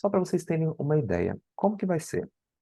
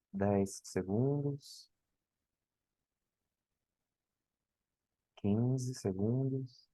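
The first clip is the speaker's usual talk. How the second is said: soft, slow breathing coach; guided breathing; 4 dB quieter than usual. Acted. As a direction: count them through it gently. Guides a timed breath hold.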